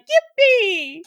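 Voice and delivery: high, loud cartoon shouts; Mario yippee woohoo. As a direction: squeal them like Mario. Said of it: "You say something like".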